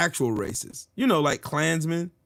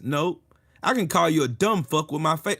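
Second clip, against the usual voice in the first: usual speaking voice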